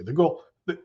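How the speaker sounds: talking through hiccups; words cut by a hiccup. Real, not acted. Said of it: "sorry, I'm burping and now I'm hiccuping".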